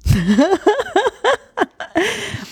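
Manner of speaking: long, high-pitched laughing fit; helpless high laugh; real laughter. Real, not acted. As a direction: burst out laughing at your own joke. Laughs at own pronunciation joke.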